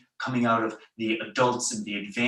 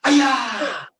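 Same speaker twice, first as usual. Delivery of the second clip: loud, high martial arts kiai; sharp karate-class battle cry; loud yell; 12 semitones higher, 7 dB louder than usual. Shown as a demonstration. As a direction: yell it out high, falling away at the end. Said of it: "Loud yell".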